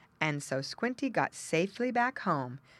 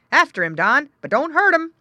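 loud, rising call to a dog; farmer's called order; 10 semitones higher, 12 dB louder than usual. A narrator's reading. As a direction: send the dog off, with a caution. Farmer sends dog after pig.

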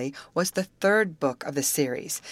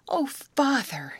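high, breath-caught gasp, drawn out; 10 semitones higher, slower than usual. A narrator's reading. Grace slipping into her father's waiting arms, gasping. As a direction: gasp it out slowly, pitched high. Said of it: "gasped Grace".

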